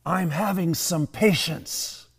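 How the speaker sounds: slow, put-on small child's voice; drawn-out, effortful little-kid reply; little girl. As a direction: play a three-year-old straining to be patient. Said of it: "the little girl unclenches her fist".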